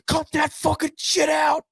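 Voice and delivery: very high, strained mock shout; Saban. Coach to players doing horns down, mock furious shout. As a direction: imitate an apoplectic coach, squeezed and high without extra volume. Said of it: "about to have an aneurysm".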